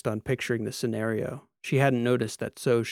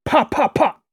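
high, loud percussive pops; acted engine firing; imitated engine pops; 14 semitones higher, 11 dB louder than usual. A narrator's reading. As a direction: fire off three sharp bursts.

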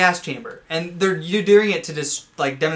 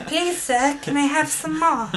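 put-on high, slow pleading child orphan; 11 semitones higher, slower than usual. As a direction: beg like a hungry workhouse orphan. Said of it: "Your best orphan voice".

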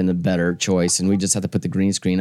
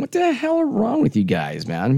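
high exasperated squeal; 11 semitones higher than usual. Retold or imitated. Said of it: "I'm like going".

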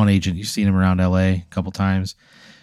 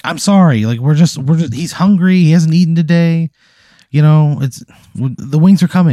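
higher, pleading mom voice; apologetic mom; 7 semitones higher, 5 dB louder than usual. Acted. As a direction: plead for understanding, pitched up and louder. Flustered mom apologizes to crowd.

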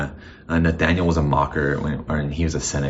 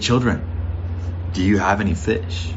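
slow, tender calling out, pitched up; gentle call; 4 semitones higher, slower than usual. Retold or imitated. Jesus calls to the tired fishermen, slow and tender. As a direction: call to them kindly like a father from the shore. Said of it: "that's not his tone".

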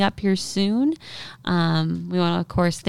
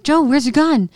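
alarmed question; 9 semitones higher, 6 dB louder than usual. Retold or imitated. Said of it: "I'm like".

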